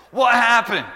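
loud high alarmed call; dad shouting to his son. Retold or imitated. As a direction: shout it from the next room.

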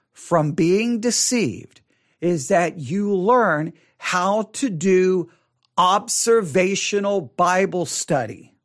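very slow, deliberate delivery with pauses between phrases; measured, weighty, unhurried teaching; as slow as possible. Real, not acted. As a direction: spell out the key point phrase by phrase, slowly. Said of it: "say this as slowly as I can".

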